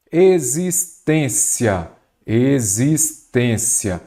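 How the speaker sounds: "existência" very slow, leaning long on TÊN; syllable by syllable. Shown as a demonstration. Teacher locating the strong syllable in existência, slowly sounded out. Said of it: "vamos separar a palavra em sílaba".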